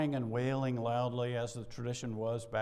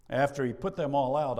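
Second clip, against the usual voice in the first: emphatic; 3 semitones higher, 9 dB louder than usual. Shown as a demonstration. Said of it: "I love that".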